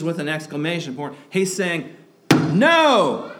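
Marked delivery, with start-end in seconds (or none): 2.5-3.3 a loud, high, forceful NO; emphatic shout. Retold or imitated. Preacher voicing Paul's answer to sinning more, shouted. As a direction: throw the "No!" out as hard as you can. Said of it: "Paul's doing this as strongly as he can".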